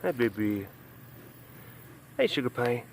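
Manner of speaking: soft, hushed pet talk; tender greeting. Real, not acted. Greeting a pretty girl among the bushes, tender. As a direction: coo it gently and quietly.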